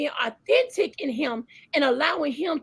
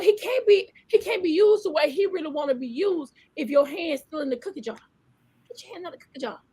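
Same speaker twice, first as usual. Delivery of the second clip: rising preacher cadence, calls and drops; preaching; 2 semitones higher than usual. Acted. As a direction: preach it out to the listeners. Preaching to listeners.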